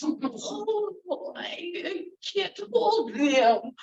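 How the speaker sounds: put-on high, slow frail old woman; quavering as her hands fumble the cards; old woman's voice. Fortune teller fussing over dropped cards, high and slow.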